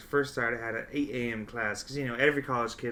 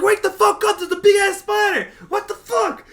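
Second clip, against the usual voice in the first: much higher, louder shouting, laughing at end; panicked yell; 11 dB louder than usual. Retold or imitated. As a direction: pound the door and yell. Reenacted banging on roommate door.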